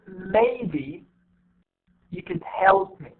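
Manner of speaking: slow MAYBE, pause, then "you could" light before HELP; stress on MAYBE, HELP. Shown as a demonstration. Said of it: "you see how I pronounce it".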